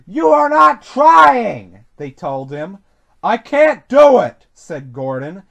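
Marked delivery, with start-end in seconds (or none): 0.1-1.9 loud, slow, high reprimand; stern storybook telling-off; severe scolding. A narrator's reading. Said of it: "talking to him severely". 3.2-4.5 loud, higher protest; exasperated storybook engine. A narrator's reading. Gordon protests at scolding.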